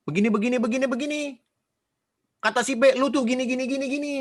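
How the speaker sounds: high, rattled-off tattling; mock tale-bearer, high and pushy; gossiping go-between. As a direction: pile up the accusations in a rising, insistent chant. Go-between stirring up A against B.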